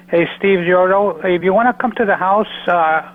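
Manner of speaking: usual speaking voice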